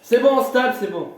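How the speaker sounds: loud, high cut-off; forceful interruption. Acted. Candidate cutting off his rival, loud and exasperated. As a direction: shut him up over his talking.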